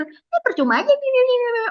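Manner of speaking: a classmate taunting Putri, sneering and high. Retold or imitated. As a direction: needle her in a high, snide voice. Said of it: "Dia makin nyinyir".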